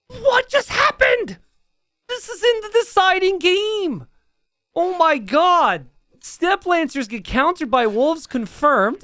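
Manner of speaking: disbelieving high exclamation; stunned shout. Real, not acted. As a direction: gasp it out in shock. Caster stunned by wolves.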